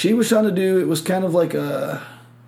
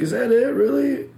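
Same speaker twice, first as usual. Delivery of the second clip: much higher incredulous question; mock disbelief; 9 semitones higher, 3 dB louder than usual. Saleswoman questioning his hair routine, mock disbelief. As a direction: pitch it right up, disbelieving.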